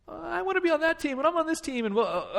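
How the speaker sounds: high mock-whiny little kid; imitated crying child. Boy complaining about basketball teams, imitated crying. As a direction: wail it like a tearful boy, exaggerated. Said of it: "he's crying".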